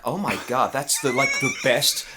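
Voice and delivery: put-on loud, high American accent; gushing American. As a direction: gush like an impressed American tourist. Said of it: "if you're American, you're like".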